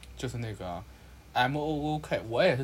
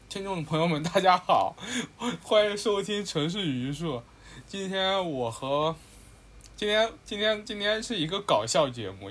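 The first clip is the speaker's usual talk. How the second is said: higher, announcer-style podcast welcome; formal host intro; 5 semitones higher than usual. Acted. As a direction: open the show like a formal host. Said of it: "这么正式的说了一通".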